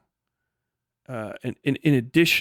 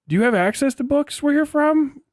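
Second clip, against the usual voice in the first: much higher and louder, condescending; mocking high voice; 13 semitones higher, 5 dB louder than usual. One side mocking the other's schooling, high and condescending. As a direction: sneer the question up high like talking down.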